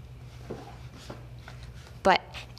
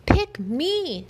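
high, bright begging cry; a puppy begging to be chosen; eager plea; 11 semitones higher, 3 dB louder than usual. A narrator's reading. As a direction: beg to be picked, pitch way up.

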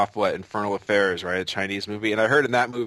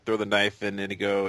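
normal talking voice

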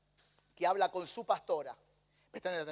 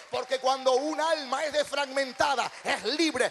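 louder, rising preacher's cry; preaching at full force; 4 semitones higher, 4 dB louder than usual. Real, not acted. Preacher rallying the congregation for next year, loud and rising. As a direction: build the congregation up toward a triumphant shout.

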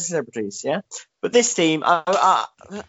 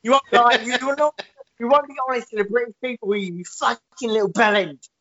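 higher, ranting London hard-man voice; Danny Dyer impression; 8 semitones higher than usual. Danny Dyer ranting at Boris about the British people, higher. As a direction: tear into him like a street lad. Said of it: "fucking Danny Dyer's like".

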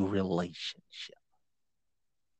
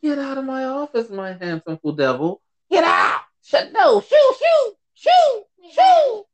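playful shooing. Real, not acted. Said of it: "Y'all just heard me tell my little man".

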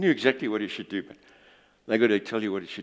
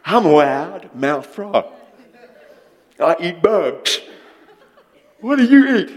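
loud, slow, cartoonish frog boasting, jumping high on the question; boastful frog; 6 semitones higher, 10 dB louder, slower than usual. Preacher telling a frog joke to the congregation in a loud, high, cartoonish frog voice. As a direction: puff up like a cocky cartoon frog. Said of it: "and he says".

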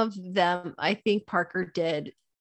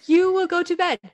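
higher, louder commanding mom; firm parental order; 10 semitones higher, 7 dB louder than usual. Acted. Mother orders defiant daughter to bed, firmly. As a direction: lay down the law to a child.

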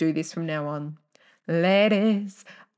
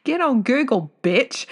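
loud retort; 7 semitones higher, 6 dB louder than usual. Shown as a demonstration. Telling picky listeners off loudly. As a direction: Get on Google, bitch yelled high and loud.

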